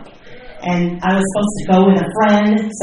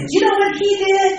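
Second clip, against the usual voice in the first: pitched way up and fast, indignant; indignant gossip; faster than usual. Retold or imitated. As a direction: blurt it out like fresh outrage. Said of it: "I'd call up and I'd say".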